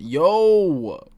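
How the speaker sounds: long, startled holler; shocked outburst. Real, not acted. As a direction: cry out in playful disbelief. Shocked reaction to the scene.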